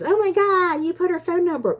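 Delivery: very high, hammed-up scandalized voice; exaggerated high-pitched fake shock; mock outrage. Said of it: "so before somebody goes".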